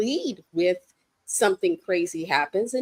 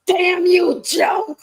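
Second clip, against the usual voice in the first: much higher and louder, cursing the writer; hammed-up betrayed yell; mock outraged shout; 9 semitones higher, 8 dB louder, much slower than usual. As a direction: yell it at the writer in fake fury.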